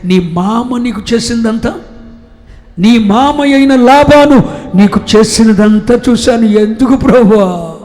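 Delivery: loud, high, impassioned sermon; preacher in full flight; fiery preaching. Acted. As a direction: thunder it at the congregation.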